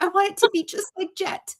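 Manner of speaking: lightly imitated high-pitched little kid; admiring kid. Kid wanting to copy a friend's cannonball, lightly imitated. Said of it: "all his friends wanted ... and they're like".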